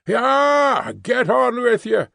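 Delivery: high shouted goad to the team; coachman's high urging cry; driving horses on. Acted. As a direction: yell them on, pitched high.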